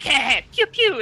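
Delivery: rising mouth laser blasts; pew pew gun sounds. Acted. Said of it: "the block's like".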